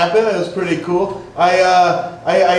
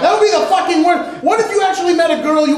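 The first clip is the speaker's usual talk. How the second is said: exasperated shout; 9 semitones higher than usual. Real, not acted. Comic telling the crowd clubs are awful, shouting. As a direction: yell the punchline in disbelief. Punchline exclaimed after stoner bit.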